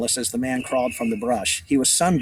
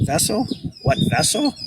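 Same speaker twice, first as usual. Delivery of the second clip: put-on much higher puzzled boy; bewildered, questioning squeak; baffled; 11 semitones higher than usual. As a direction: echo the odd word in high-pitched confusion.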